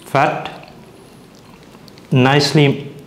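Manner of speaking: ordinary talk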